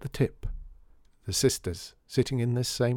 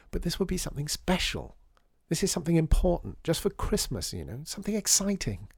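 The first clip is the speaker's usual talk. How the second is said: put-on higher-pitched, hurried young visitor making her case; earnest pleading Lydia; 6 semitones higher than usual. Lydia begs Firuza to allow something exciting, earnest pleading. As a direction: plead for something special to happen.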